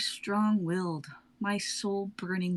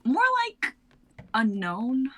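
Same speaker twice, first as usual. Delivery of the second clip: much higher, louder teasing lead-in; high emphatic; 6 semitones higher, 4 dB louder than usual. Acted. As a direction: lift it up. Bia's high emphatic reply.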